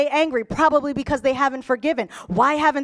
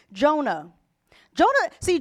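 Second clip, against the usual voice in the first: ordinary talk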